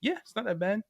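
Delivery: high, soft mock girl's voice; imitating the girl. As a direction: say it small, high and quiet like her. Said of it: "she goes".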